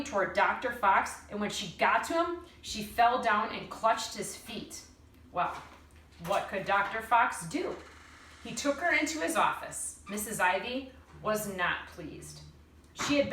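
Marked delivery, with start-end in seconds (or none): none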